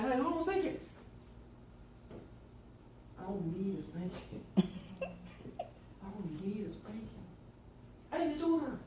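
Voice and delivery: high-pitched, cheeky mock protest; sarcastic kid. Boy talking back to mother about spankings, sarcastic. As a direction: plead your case like a smart-mouthed child. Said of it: "I'm really sarcastic".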